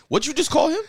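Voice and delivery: high, loud retold disbelief; imitating shocked friends, pitch way up; mock astonishment. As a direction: act out a stunned onlooker demanding an answer. Acting out stunned crowd's question.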